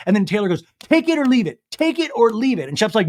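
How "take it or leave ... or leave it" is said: exasperated. Retold or imitated. Taylor snapping at the group.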